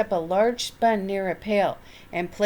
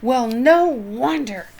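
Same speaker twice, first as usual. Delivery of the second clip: loud, slow, higher bellow; 4 semitones higher, 5 dB louder, slower than usual. A narrator's reading. Mister Wilson glaring at Dennis over the sponge cake, bellowing. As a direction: boom it out at the boy in anger. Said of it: "bellowed Mister Wilson".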